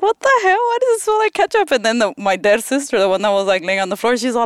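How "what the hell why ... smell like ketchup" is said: confused surprise. Retold or imitated. Confused at ketchup smell.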